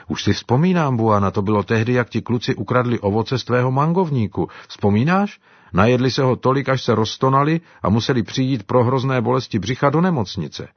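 lightly voiced old helper, fondly reminiscing; Daudy's voice. (lehce odlišený hlas Daudyho, vzpomínavý)

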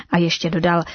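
usual speaking voice

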